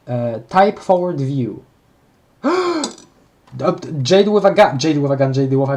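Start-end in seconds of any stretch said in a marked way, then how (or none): 2.4-2.9 quick high intake of breath; startled high gasp; sharp gasp. Real, not acted. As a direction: gasp in surprise. Gasp at Jade image.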